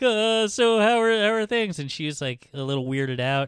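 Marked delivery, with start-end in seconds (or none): 0.0-1.8 high, loud awkward small talk; hammed-up nervous attempt at being casual; nervous Gatsby. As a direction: imitate flustered stumbling, pitched way up. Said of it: "all nervous".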